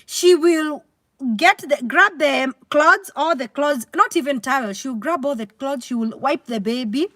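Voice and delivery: normal talking voice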